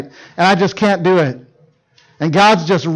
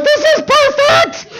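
much higher, laughing, slow; gleeful shout; slower than usual. Retold or imitated. Dad burying his daughter in snow, laughing shout. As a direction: crow with delight. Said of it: "I'm like".

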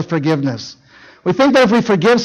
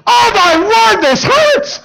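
much higher, louder, whimpering; pained cry; 3 dB louder, much slower than usual. Retold or imitated. Husband to his wife in the bedroom, pained high cry. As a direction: wail about the broken wrist. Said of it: "I was like".

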